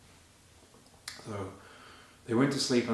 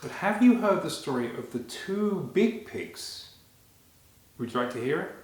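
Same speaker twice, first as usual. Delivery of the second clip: put-on overbright kids party host; 3 semitones higher, 3 dB louder than usual. Greeting children to open story.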